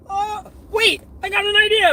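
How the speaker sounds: high-pitched, excited outburst; sudden inspiration. Retold or imitated. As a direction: burst out with a bright idea. Sudden idea in acted retelling.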